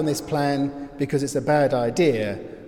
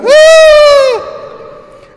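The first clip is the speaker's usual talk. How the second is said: piercingly high, very loud re-enacted scream; scream in reply; 19 dB louder than usual. Sleeper hearing his roommate scream, screaming again. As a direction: scream back, even more startled. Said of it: "heard him scream and made me go".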